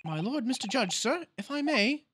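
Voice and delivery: high, eager juror pleading; excited juror. Acted. Juror addressing the judge, high eager plea. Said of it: "juror changes vote".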